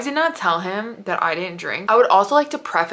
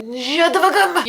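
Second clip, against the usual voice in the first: exasperated; 9 semitones higher than usual. Real, not acted. Mocking his excuse.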